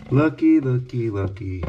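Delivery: starts high and loud, falls back; looky looky fading down; excited to dismayed. Real, not acted. Showing damage.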